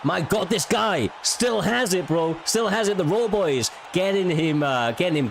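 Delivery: hyped-up commentary yell; excited shout. Real, not acted. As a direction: shout it out, thrilled. Caster hyped by big finish.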